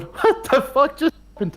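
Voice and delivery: high, stunned disbelief; Arthur's stunned reaction. Retold or imitated. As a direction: blurt it out in total shock. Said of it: "the laugh that we hear from Arthur in response is Roger Clark going".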